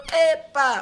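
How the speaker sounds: sharp high Epa; high exclamation. Retold or imitated. Family shouts Epa at the hungry guest. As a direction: shout it like an outraged Italian family. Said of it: "You know".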